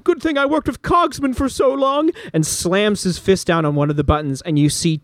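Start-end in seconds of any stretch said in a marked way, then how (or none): 0.0-2.1 high, pleased character voice; smug villain. Acted. GM voices Berzin finding the buttons, high and smug. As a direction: gloat to yourself over the buttons. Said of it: "and says".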